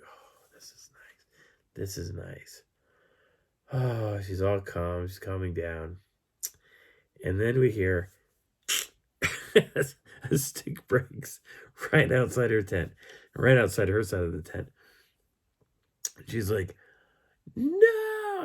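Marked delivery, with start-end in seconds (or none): 0.0-1.3 slow, whispered imitation of someone nestling; hushed, drawn-out contentment; contented whisper. Imitating her settling down cosily. 1.7-2.6 softly voiced imitation of cosy contentment; contented murmur. Same words repeated more audibly. 17.6-18.4 high-pitched, louder mock squeal; hammed-up frightened cry; imitated panic. Acting her panic at stick break.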